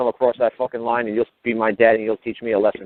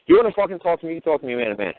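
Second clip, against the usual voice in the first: raised confrontational voice; 5 semitones higher than usual. Retold or imitated. Confronting the cop.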